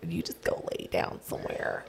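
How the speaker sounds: soft whispered aside to the dog; hushed murmur to a pet. Real, not acted. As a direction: breathe it to the dog, barely voiced.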